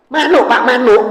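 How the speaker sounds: high, loud impatient snap; irritated snapping. Retold or imitated. Son snaps at his old father on the phone, annoyed. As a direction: bark it out, fed up.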